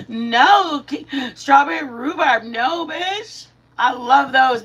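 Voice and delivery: high, loud thrilled gasp; gasping delight. Real, not acted. Streamer reacts to a viewer's favorite pie, thrilled. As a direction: react with playful disbelief.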